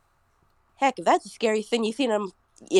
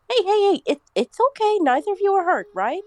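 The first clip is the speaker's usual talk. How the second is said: higher, soothing character voice; calm the kids down, pitched up; Herb's gentle voice; 10 semitones higher than usual. Acted. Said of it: "he goes over, like".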